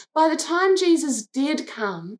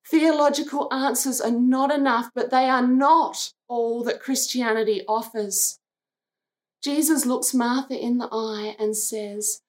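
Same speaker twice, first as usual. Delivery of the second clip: ordinary talk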